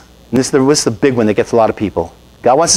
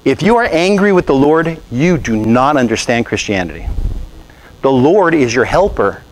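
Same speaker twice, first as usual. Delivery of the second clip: ordinary talk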